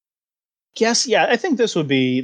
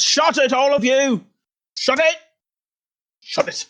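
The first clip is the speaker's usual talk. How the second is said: much higher, hammed-up bellow; 11 semitones higher than usual. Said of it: "bellows out and says".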